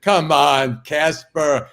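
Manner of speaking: loud, high hammed-up TV host; skit host voice. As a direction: call him out like a game-show host. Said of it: "and they said".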